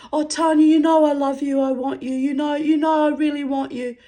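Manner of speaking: very high, loud whiny mock plea; imitating a man begging in front of his mates; mock pleading. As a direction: ham up a lovesick simp begging. Ex voiced pleading.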